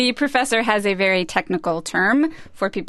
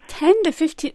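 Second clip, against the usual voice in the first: incredulous, pitched higher, questioning back; surprised echo; 7 semitones higher than usual. Real, not acted. Host echoing the professor's figure, surprised. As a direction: repeat the number in disbelief.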